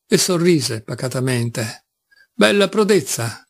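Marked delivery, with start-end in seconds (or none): 2.3-3.5 higher, drawn-out exclamation; narrated scornful cry. A narrator's reading. Rakitin reacts to Alyosha not drinking, crying out slowly. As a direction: draw it out high, a restrained cry. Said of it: "gridò Rakitin".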